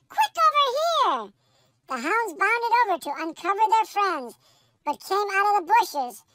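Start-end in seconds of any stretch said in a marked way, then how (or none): 0.1-1.3 high, eager call across the park; excited pup yelling a find; yelled. A narrator's reading. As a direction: shout it out to the hounds, pitched up. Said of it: "sniffed a few times and yelled".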